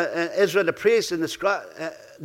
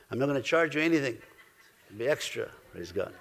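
joking aside; 6 semitones lower, 8 dB quieter, faster than usual. Real, not acted.

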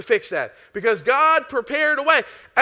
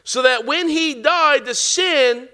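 slow, deliberate scripture reading; 2 semitones higher, 5 dB louder, slower than usual. Acted. Preacher reading scripture slowly to the church. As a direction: lean on each word of the verse.

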